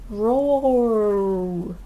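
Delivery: cheerful mock roar, feeling better; dinosaur roar. A narrator's reading. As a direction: roar like a happy toddler's dinosaur. George roars, feeling better.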